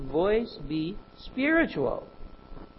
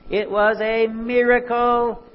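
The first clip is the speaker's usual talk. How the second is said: a higher, louder, slow insistence; emphatic point; 7 semitones higher, 8 dB louder than usual. Real, not acted. Preacher insisting to listeners that the healing was a miracle, slow and emphatic. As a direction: land it like the obvious truth.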